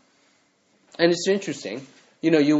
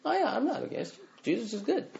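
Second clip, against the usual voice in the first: much higher, softer, fake-sweet voice; mock the shallow friendly believer, sing-song and high; high, breezy mock of people who don't commit; mock nice churchgoer; 10 semitones higher, 5 dB quieter than usual. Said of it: "they say".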